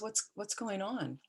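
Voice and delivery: soft, worried bedside question; hushed concern. Retold or imitated. Brother softly asks the crying patient if he's okay. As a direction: ask it gently under your breath.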